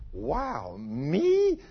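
slow astonished self-question; shocked disbelief. Retold or imitated. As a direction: gasp it out like you can't believe it's you. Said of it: "he thought".

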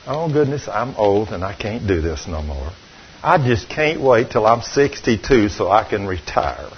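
put-on sighing as if too old to go on. Preacher mocking defeated Christians, softly whiny. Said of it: "Christians talking like that".

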